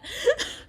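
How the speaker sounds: short high burst of laughter. Real, not acted. Gamer laughing at their own frustration, a high burst. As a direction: let a high laugh escape.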